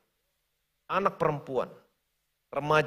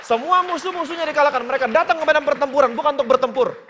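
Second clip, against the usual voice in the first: higher, louder preaching climax; preaching at full force; 7 semitones higher, 9 dB louder than usual. Acted. Preacher proclaiming victory to the congregation, fervent.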